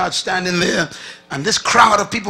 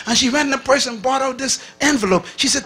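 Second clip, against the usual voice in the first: ordinary delivery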